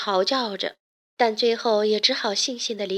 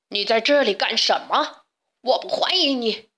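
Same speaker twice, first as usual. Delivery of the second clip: narrator's understated beast roar. A narrator's reading. Beast confronts an intruder in its castle, roaring mildly. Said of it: "野兽咆哮着".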